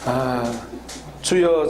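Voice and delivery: ordinary talk, nothing marked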